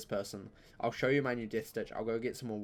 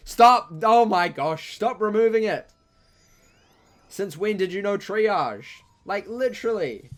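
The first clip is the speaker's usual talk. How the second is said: much higher, very loud outraged yell; yelling stop; 9 semitones higher, 12 dB louder, slower than usual. Real, not acted. Streamer yelling at the opponent for removing his buffs, outraged shout.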